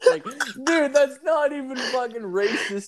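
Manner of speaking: high, laughing through words; cracking up mid-rant. Real, not acted. Laughing at the ban.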